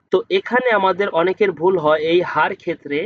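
usual speaking voice

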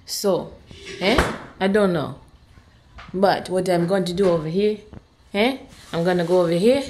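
low, soft put-on Nigerian accent. Mom trying her ancestral voice while planning dinner, playful Nigerian accent.

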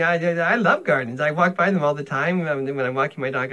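usual speaking voice

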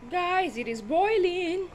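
high, drawn-out sing-song call; excited announcement. Real, not acted. Excited call-out to viewers while cooking.

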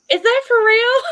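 high, loud mock disbelief; disbelieving surprise. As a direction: squeal it in mock shock. Retold disbelief at Bahamian.

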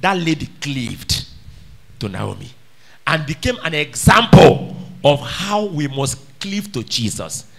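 ordinary delivery

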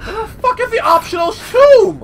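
exasperated yell. Real, not acted. Gamer yelling at the game, exasperated. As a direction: vent at the game. Frustrated searching.

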